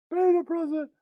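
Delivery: soft echo. Acted. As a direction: drop it to a mutter.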